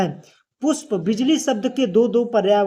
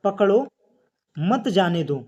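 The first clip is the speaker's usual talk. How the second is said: पकड़ो said alone, clear pause, then मत जाने दो; pause after पकड़ो. Shown as a demonstration. Teacher showing students how a comma works, pause after पकड़ो. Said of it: "जैसे कहा जाए".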